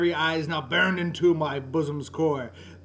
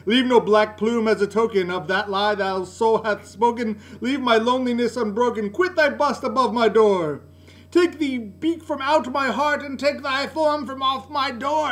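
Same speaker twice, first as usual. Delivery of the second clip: loud, higher shriek at the bird; 6 semitones higher, 5 dB louder than usual. Acted. Narrator driving the raven away, shrieking. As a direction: hurl the words at the fiend. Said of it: "I shrieked".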